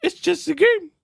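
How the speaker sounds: high, teasing mock voice; meme guy impression. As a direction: needle a sore loser with a grin. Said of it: "the dude going".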